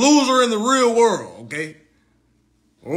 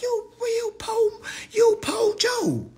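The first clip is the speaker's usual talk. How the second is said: high stammering mock voice; mock sputter; slower than usual. Imitating herself sputtering at him, high and slow. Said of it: "I'm like".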